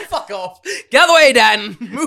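mock yell. Telling Dan to get out of the road with a loud mock yell. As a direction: bark it at the slowpoke. Yelling at friend blocking road.

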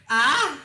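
mock scream. Mocking friend's scream.

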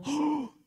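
sharp high gasp of amazement; astonished gasp. Acted. Servant looks out at the heavenly army, amazed gasp. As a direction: suck in air, acting awestruck.

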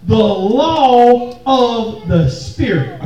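The law of the Spirit, slow with weight on law; slow driving repeat. Shown as a demonstration. Preacher urging congregation to grasp it, slow emphatic. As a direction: drill it in for them to grab. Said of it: "if I could just get y'all to grab this".

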